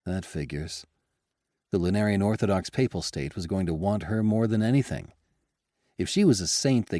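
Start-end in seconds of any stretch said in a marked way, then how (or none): none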